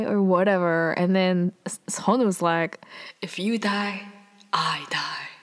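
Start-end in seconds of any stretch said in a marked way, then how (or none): 3.2-5.3 hushed, low imitation of a romantic hero; solemn lover's vow. Sunwoo answering Aro's worry, imitated as solemn and tender. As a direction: pause before the second half, softly grave.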